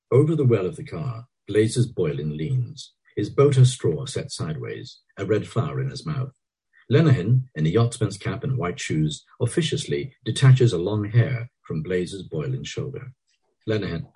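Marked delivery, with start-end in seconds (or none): none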